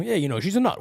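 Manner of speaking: dad joking about his wife; a hint of dad's teasing. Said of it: "my father always throws that one out there".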